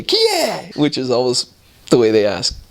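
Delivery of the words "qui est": a high, mock old lady calling from the back; older woman's voice. Elderly woman calling from the back to the door: high mock old voice. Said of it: "we heard an older voice say".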